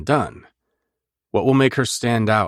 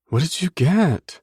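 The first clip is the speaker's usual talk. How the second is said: female voice; 10 semitones higher, slower than usual. Acted. Heroine asks about food.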